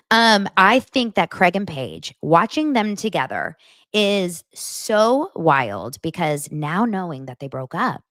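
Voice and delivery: usual speaking voice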